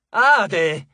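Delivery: a loud shocked cry; shouted name. Acted. Host recoils on hearing of Hades, loud cry. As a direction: spit the name out in alarm. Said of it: "gridò il loro ospite".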